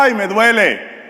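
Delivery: high, hammed-up complaint of pain; comic patient crying out in pain; mock cry of pain. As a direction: whine that it hurts, for laughs.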